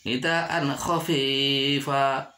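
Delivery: slow "nidaan khafifa" with a clean n; "nidaan khafifa", long vowels, no hum; clear idhar reading. Shown as a demonstration. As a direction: cut "nidaan" before "khafifa". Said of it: "tidak boleh dibaca".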